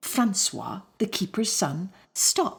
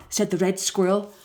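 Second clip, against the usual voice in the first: plain reading voice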